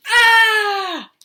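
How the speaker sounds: high shocked shriek; startled scream. Acted. Walrus shrieking in fright at the cub's boo. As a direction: yelp as if jumped on.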